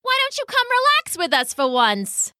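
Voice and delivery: very high, lazy coaxing bird; teasing pheasant. Acted. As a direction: coax him up high and lazy. Pheasant teases the mouse.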